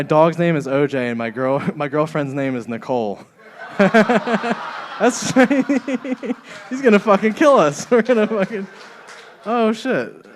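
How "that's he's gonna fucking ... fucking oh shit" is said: breaking up laughing mid-sentence; high, loud laughter through the words; cracking up in real laughter while talking. Real, not acted. Laughing at his own realization.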